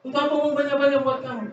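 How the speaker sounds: hammed-up furious dad; angry father. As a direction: scold the child fast and high, exasperated. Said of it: "Marah-marah papanya".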